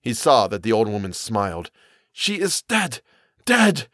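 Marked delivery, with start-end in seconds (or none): 2.1-3.9 high, halting fearful voice; stunned, pitched-up character voice; mumbled dread. A narrator's reading. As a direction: insist in a daze, rising in force. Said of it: "Kurtz mumbled".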